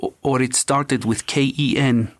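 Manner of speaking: a light put-on Slavic accent; mock Slavic accent. Psychic channeling for police, mock Slavic accent. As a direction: imitate the psychic's Slavic accent, half-hearted. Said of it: "in a Slavic accent sometimes".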